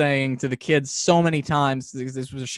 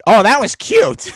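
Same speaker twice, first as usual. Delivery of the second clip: amused puppeteer; 8 semitones higher, 10 dB louder, slower than usual. Retold or imitated. Puppeteer cracking up on set.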